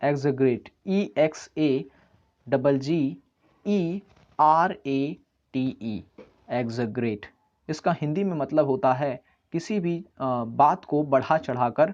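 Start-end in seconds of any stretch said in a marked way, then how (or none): 0.9-6.0 each letter spaced out slowly; slow spelling. Shown as a demonstration. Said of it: "spelling a word aloud". (धीरे-धीरे अक्षर बोलते हुए)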